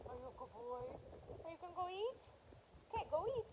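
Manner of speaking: soft, high voice for a pet; pet talk. Real, not acted. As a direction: gently coax the dog to eat. Cooing to the dog.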